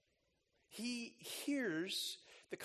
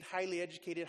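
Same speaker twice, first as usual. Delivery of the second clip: ordinary talk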